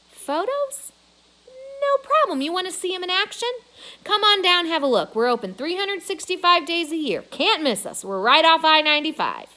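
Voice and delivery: high, loud, fast pitch; eager salesman. A narrator's reading. Boss pitching his mall to a reporter, eager high sales pitch. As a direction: sell it hard and cheerfully. Mac turning salesman on phone.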